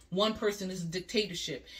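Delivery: usual speaking voice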